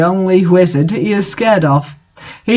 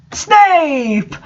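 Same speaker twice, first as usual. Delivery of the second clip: high, sudden reveal of the name; dramatic reveal; 9 semitones higher than usual. A narrator's reading. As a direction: announce the villain with a gasp.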